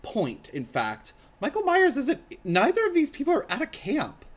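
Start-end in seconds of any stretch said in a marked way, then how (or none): 1.4-4.2 high, loud disbelief; indignant surprise. Real, not acted. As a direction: protest the plot hole. Outraged interruption of the script.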